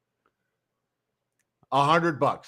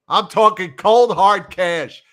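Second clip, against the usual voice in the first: emphatic; 5 semitones higher, 6 dB louder than usual. Real, not acted. Insisting on real cash bet.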